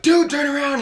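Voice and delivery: loud, high excited shout; excited urging. Retold or imitated. Buddy spotting [name], loud excited shout. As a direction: yell it while slapping his back. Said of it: "the hardest slap on the back".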